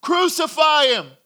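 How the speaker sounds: loud, high mob cry; forceful high-pitched demand. Acted. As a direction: shout it out over the crowd. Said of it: "they shouted all the louder".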